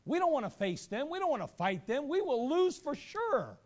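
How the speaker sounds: high, loud panicked voice; panicked Gibeonites. Retold or imitated. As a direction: whine in alarm. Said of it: "they said to themselves".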